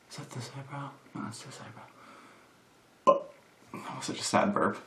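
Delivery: barely audible, near-whispered self-talk; muttering to self. Real, not acted. Checking her own eyebrows, muttering to herself. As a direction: mumble it very softly to yourself.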